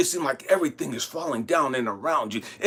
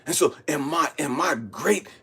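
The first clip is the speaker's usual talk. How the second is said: normal talking voice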